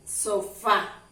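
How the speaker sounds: "sofá" with a strong final "fá"; fuerza en la última. Shown as a demonstration. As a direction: put the weight on the accented á of "sofá". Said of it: "mayor fuerza en esta última letra". ("sofá" con mayor fuerza en la "á" final)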